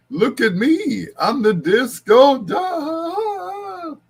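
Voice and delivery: very high, loud cartoon duck, last word stretched into quacking. Imagining [name] singing Disco Duck, high quacking duck voice. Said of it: "imagine [name] singing Dis-, Disco Duck".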